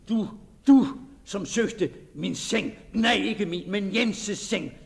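loud, bitter lament at betrayal; bitter outcry. Acted. Betrayed diarist cursing his faithless lover, loud and bitter. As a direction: rage at the false woman and at himself.

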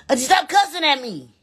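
high, loud mock complaint to cussing auntie; loud high protest. Real, not acted. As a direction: cry out to auntie to quit cussing.

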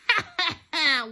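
high, loud mischievous cackle; gleeful cackle. A narrator's reading. Poltergeist catching students out at night, cackling. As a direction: cackle with wicked delight. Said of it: "Peeves cackled".

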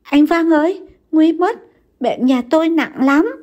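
high, loud, near-sobbing plea; tearful plea. A narrator's reading. Wife runs to Văn, pleading tearfully for help. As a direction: beg for help on the verge of tears. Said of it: "mếu máo nói với Văn".

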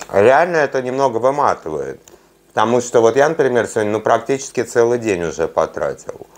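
speaking with food in mouth; muffled mid-bite speech; talking while chewing. Real, not acted. Seen 2: bites nugget at -2s; pursed, working mouth while talking afterwards.

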